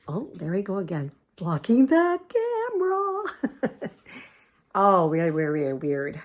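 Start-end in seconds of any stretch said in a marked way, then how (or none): 1.4-3.9 high, slow sing-song to the dog; drawn-out high pet voice; doggy talk. Real, not acted. Talking to the dog.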